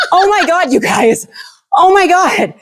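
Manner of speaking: high-pitched, laughing disbelief; retold amazed outburst. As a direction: relive overwhelmed joy, pitch leaping up. Retells amazement at dog breathing.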